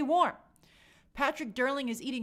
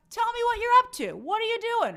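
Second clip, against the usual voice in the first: excited; 11 semitones higher, 8 dB louder than usual. Real, not acted. Asking chat for shout-outs.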